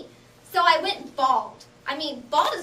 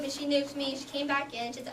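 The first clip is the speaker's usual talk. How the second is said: normal talking voice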